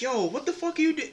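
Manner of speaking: high-pitched retold alarm; imitating alarmed friend. Friend confronts him at the sink.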